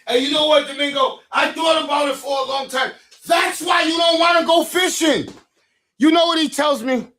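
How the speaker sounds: turning on Domingo in front of the audience, loud; loud call-out. Real, not acted. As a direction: raise it up, a public jab.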